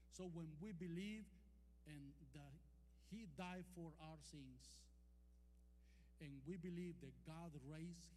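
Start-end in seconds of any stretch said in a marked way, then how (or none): none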